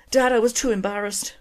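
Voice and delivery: Irish daughter owning up to her father. Acted. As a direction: confess shyly in an Irish lilt. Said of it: "excuse the accent".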